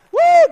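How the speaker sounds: a high, loud whoop of delight; joyful whoop. Real, not acted. Preacher whooping for joy mid-sermon, loud high whoop. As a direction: let out a big happy woo.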